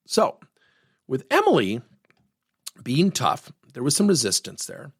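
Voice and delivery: ordinary delivery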